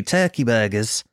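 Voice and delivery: usual speaking voice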